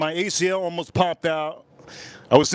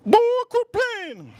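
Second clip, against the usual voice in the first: very high, drawn-out falsetto; stripper imitation; slower than usual. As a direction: imitate her in a slow, squeaky-high voice. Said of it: "She goes".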